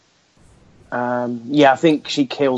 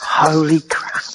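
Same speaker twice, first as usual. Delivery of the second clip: stunned slow whisper; awed whispered reaction; 3 semitones higher than usual. Real, not acted. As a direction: breathe it out in disbelief.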